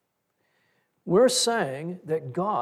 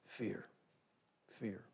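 hushed; 6 semitones lower, 17 dB quieter than usual. Acted. Preacher names the root emotion.

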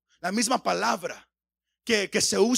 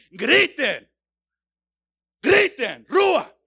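loud, single-word cries climbing higher; shouted command; 5 semitones higher, 8 dB louder, much slower than usual. Shown as a demonstration. Preacher urging congregation to shout as the psalm says, shouted command. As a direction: punch out each word as a rousing shout.

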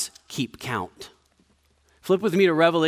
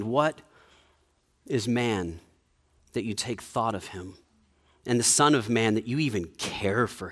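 scripture reading; 4 semitones lower than usual. Acted. Reads Psalm eight aloud.

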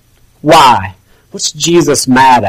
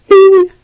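sudden much higher "Bing" like a magic chime; magic bing sound. Pretend. Preacher mocks a showy miracle with a high bing. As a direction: pop it out like a conjurer's ta-da. Mock magic trick at the tomb.

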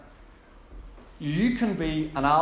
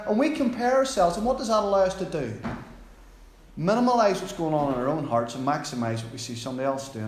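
ordinary talk

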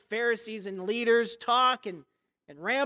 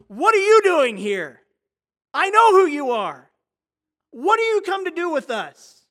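loud, higher frantic yell; 6 semitones higher, 12 dB louder than usual. Acted. Possessed man yells at Jesus mid-sermon, frantic loud yell. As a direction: shriek it at the preacher in a panic. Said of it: "And he's yelling".

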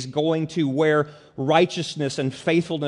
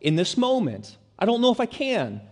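higher, pleading doubt; fearful inner voice, raised pitch; voicing fear; 8 semitones higher, 3 dB louder than usual. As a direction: voice a frightened believer's doubt. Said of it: "the fear is like".

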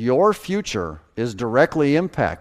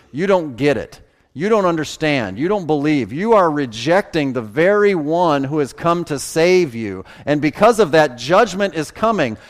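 rising preached rebuke, getting louder and higher; Jesus rebuking; 5 semitones higher, 5 dB louder than usual. Retold or imitated. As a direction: thunder the warning of judgment at a city that won't listen. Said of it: "In other words, Jesus says".

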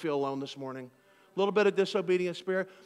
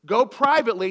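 "privately" punched louder and higher; stress on privately; 4 semitones higher, 7 dB louder than usual. Acted. Preacher repeating the verse with emphasis. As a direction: hit "Go privately" hard. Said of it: "You could underline that".

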